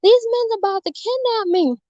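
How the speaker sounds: put-on scared kid yelling mommy across the street; retold yell for mommy. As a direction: call out to mother in panic. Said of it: "I yelling, I said".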